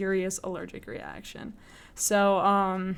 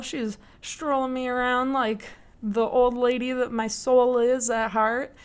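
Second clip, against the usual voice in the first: ordinary talk, nothing marked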